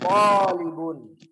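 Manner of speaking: loud, slow, long first vowel; model reading. Shown as a demonstration. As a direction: call it out slowly for the class to echo. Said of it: "Coba ane membaca".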